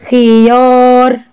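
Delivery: خيار with the final "aa" held long; slow "khi-yaaar", sounding out خيار; long vowel in خيار. Shown as a demonstration. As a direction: stretch the aa of خيار for pupils. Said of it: "مد ألف".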